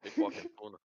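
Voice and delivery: quiet practice run at a Boston accent. Shown as a demonstration. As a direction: softly try out a Boston accent. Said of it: "How the fuck do you do a Boston accent again?".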